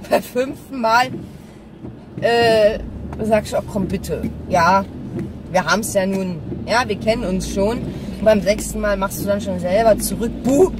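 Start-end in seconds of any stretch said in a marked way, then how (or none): none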